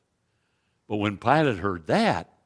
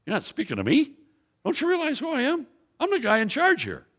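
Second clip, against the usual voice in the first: haughty, much higher mock-arrogant challenge; hubristic Pilate; faster than usual. Preacher voicing Pilate's proud misreading to the crowd, haughty and much higher. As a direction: puff up like an offended governor. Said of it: "he's being hubristic".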